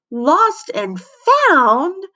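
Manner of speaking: high, rising shriek of alarm. A narrator's reading. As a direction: shriek the last word way up. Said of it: "shrieked Missy".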